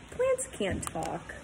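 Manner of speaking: usual speaking voice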